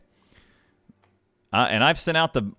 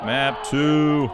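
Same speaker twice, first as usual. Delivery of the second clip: mock-epic announcement; 3 semitones higher, 4 dB louder than usual. Proclaims map choice.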